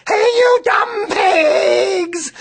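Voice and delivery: huge high drawn-out yell at the pigs; hollering, stretched slow. A narrator's reading. Girl hollering at the lazy pigs, huge drawn-out yell. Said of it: "and yelled".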